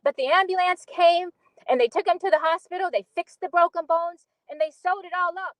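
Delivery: put-on earnest storyteller recalling a childhood accident; Beneatha monologue. Auditioning actor recalls Rufus's sledding accident, earnest dramatic monologue.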